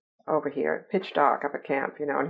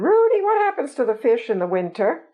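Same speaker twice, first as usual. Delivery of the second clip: high-pitched mock Southern accent; attempted Southern accent; 7 semitones higher than usual. Gullible Southern woman questioning Rudy about fish, attempted Southern accent. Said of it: "I can't do a Southern accent".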